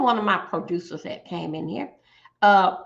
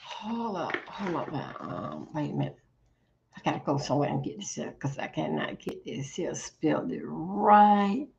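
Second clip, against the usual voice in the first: soft, half-whispered mutters to yourself; hushed private mumbling; whispered muttering; 6 semitones lower, 9 dB quieter than usual. Real, not acted. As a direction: whisper to yourself while hunting for something. Muttering to self while searching.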